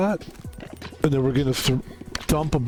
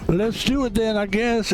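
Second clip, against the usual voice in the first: raised-pitch showman voice; Steve Harvey impression; 7 semitones higher than usual. Streamer voices the host as game MC, exaggerated impression. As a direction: go up high like a hammed-up MC. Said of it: "is gonna be like".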